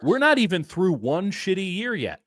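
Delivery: high-pitched exasperated rant; exasperated outburst. Real, not acted. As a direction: pitch jumps up in indignant protest. Heated outburst, co-host asks why.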